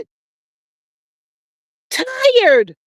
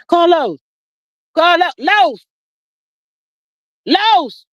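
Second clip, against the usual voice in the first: calling out; 7 semitones higher, slower than usual. Real, not acted. Calling out to Carlos.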